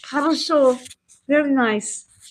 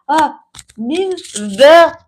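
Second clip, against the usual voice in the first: ordinary delivery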